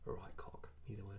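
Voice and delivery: quick hushed whisper. Real, not acted. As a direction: whisper it back. Joining in with a whisper.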